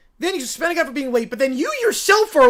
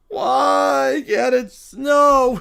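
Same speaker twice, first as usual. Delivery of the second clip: put-on very slow, louder wailing child plea; drawn-out whine; 5 dB louder, much slower than usual. Kid wailing why it can't snow, slow whine. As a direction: stretch the wail out like a sulking kid.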